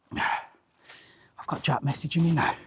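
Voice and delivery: whispered aside under his breath; hushed aside to himself. Real, not acted. Sighing aside while filming.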